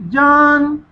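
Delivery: ordinary talk, nothing marked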